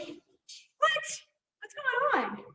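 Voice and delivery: high, puzzled what; mock surprise. Teacher to stuck student, mock surprised. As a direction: play up the surprise. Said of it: "I was like".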